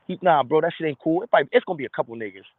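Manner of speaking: ordinary delivery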